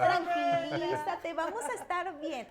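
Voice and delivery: a lightly voiced girl reassuring her crying mom; daughter's voice. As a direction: play the little daughter soothing her mother. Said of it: "mi hija llega y me dice".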